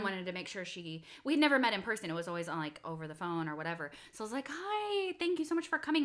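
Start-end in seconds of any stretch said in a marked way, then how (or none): 4.5-6.0 high-pitched gushing welcome; friendly greeting. Retold or imitated. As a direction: greet a guest with eager sweetness. Friendly greeting before hug acted out.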